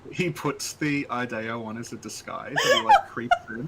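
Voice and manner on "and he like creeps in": breaking into laughter mid-line; words squeezed out through laughs; laughing. Real, not acted. Joking about sneaky monster.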